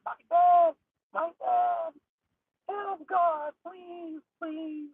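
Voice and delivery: put-on high falsetto girl voice begging for her dad; mock-tearful falsetto plea; pleading daughter. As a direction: beg in a high, breaking girl's voice. Injured daughter begging for father.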